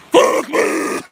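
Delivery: loud, hoarse screeched call; mock mating call. Streamer offering a mating call on request, screamed. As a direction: scream it out as a silly animal call. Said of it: "Is that a mating call".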